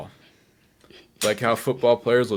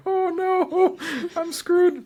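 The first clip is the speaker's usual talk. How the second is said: high squealing mock panic; mock terrified; much slower than usual. Retelling his panic in the Vader suit, a high mock-terrified squeal. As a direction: squeak it out as if scared stiff. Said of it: "I was like".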